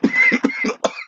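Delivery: sudden coughing burst; real cough. Real, not acted. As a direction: cough that escapes while holding it in. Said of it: "thought I could hold it".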